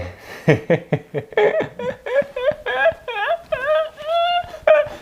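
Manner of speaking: high evil cackle; machiavellian laugh. Pretend. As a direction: cackle like a mustache-twirling villain. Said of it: "ça, c'est mon rire, euh, machiavélique".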